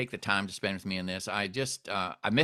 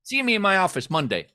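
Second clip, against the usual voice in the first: put-on cheerful doctor, higher pitched, Indian accent; upbeat Indian-accented doctor; 7 semitones higher, 4 dB louder than usual. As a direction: chuckle through the Indian accent. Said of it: "and he goes".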